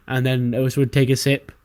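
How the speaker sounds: ordinary delivery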